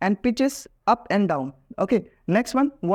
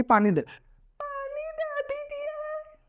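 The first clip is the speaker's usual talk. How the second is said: shrill, drawn-out, rude request for water; a mocking rude demand, pitched way up; 6 dB quieter, slower than usual. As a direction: sneer it like you're asking for acid. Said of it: "इतना rude manner में बोलना".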